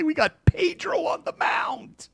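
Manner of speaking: high, breathy, cocky hype; very high-pitched mock confidence; giddy fan boast. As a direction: squeal it like it's already won.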